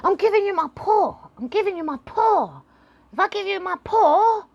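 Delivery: high, loud and fast, the dog pushing its paw on you; eager dog voice. Retold or imitated. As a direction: play an overexcited pet dog begging. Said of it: "your dog starts going".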